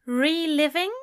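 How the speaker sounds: high, rising incredulous question; incredulous echo. A narrator's reading. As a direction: question it as if he were daft. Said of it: "looking at him as if he were daft".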